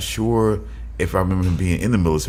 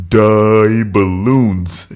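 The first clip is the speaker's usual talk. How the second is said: slow, dragged-out dopey voice; dopey baby talk; 6 dB louder, much slower than usual. Retold or imitated. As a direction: stretch it out like a dim giant. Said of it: "That was his version of baby talk".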